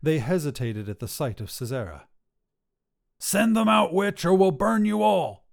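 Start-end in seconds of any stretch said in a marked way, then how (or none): 3.2-5.5 high, loud threatening shout; angry yell. A narrator's reading. Mob leader threatening the witch at her door, furious yell. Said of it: "yelled the leader".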